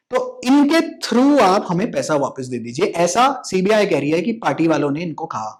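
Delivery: normal talking voice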